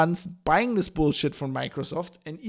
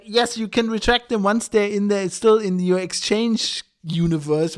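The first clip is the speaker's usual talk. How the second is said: ordinary talk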